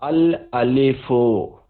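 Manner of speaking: अल अलिफो said slowly, each syllable clear, al kept whole; Arabic al-alifo. Shown as a demonstration. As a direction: sound out अल अलिफो slowly like a teacher. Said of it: "जैसे for example".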